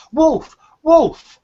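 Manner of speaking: high, loud mock alarm call; story shout. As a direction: cry out like the boy in the tale. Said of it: "you are to shout".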